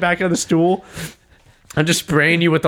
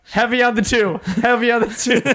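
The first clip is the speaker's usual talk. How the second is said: higher, a mock cornerman's instruction; coach calling out; 8 semitones higher than usual. Cornerman coaching his friend's fight, higher mock call. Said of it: "Just like".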